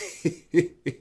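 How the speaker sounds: acted tittering laugh; tickled laugh. Whale tickled by rabbit's boast, acted tittering laugh. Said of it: "this tickled Brer Whale something terrible".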